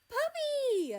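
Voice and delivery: high, loud, delighted squeal of a shout; yell. A narrator's reading. Kiwi spots the dog, yelling excitedly. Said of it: "Kiwi yelled".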